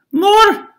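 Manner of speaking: very high, loud squeal; disguised baby demanding bread, squealing. Acted. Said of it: "said the baby".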